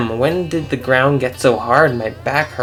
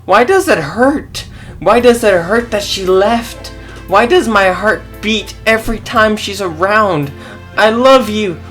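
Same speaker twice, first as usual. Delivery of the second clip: much higher, louder tearful wail; crying; 8 semitones higher, 4 dB louder than usual. Acted. Katra alone in the woods, higher tearful wail. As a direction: sob the questions out. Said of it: "I cried and I cried".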